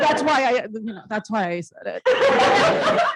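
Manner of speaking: laughing loud and high through the words. Real, not acted. Laughing at the host's teasing about the young room. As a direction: caught out, laughing it off. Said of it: "Sign here just to let us know how young this room is".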